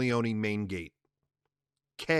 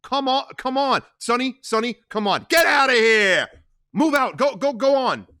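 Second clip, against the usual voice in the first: much higher, loud; urgent shouting; 12 semitones higher, 9 dB louder than usual. Acted. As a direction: rush them after Sonny. Tom rallies button men.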